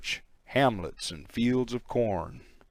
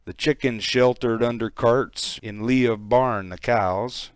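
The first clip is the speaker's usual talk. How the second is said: ordinary delivery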